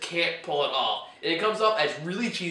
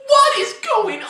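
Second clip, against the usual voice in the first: exasperated outburst; 9 dB louder than usual. Retold or imitated.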